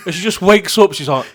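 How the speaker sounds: ordinary talk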